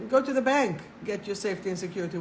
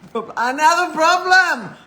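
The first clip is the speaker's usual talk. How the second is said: louder, higher, laughing at her own slip; laughing outburst; 6 semitones higher, 11 dB louder than usual. Real, not acted. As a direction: burst out laughing at the mistake.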